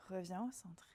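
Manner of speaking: whispered cue; hushed instruction to the class. Real, not acted. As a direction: breathe the words out without voice. Quiet cue during held pose.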